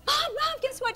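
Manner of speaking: high, breathless-with-news mock excitement; thrilled announcement. Daughter phoning mom with news, excited high voice. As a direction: burst out high with big news.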